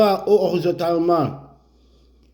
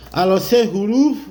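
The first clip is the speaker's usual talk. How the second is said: ordinary talk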